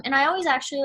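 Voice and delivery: ordinary talk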